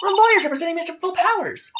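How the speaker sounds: bright, loud announcement; high eager girl. Acted. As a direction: proudly introduce the team. [name] announcing herself.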